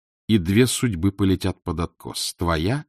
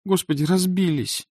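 high-pitched cry of mild dismay; dismayed gasp; 10 semitones higher than usual. A narrator's reading. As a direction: lament a small disaster in a high voice. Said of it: "охнула".

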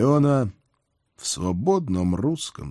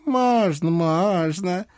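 put-on high teasing old hag; old witch voice; 10 semitones higher, much slower than usual. A narrator's reading. Baba Yaga voiced by reader.

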